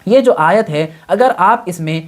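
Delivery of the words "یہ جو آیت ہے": ordinary delivery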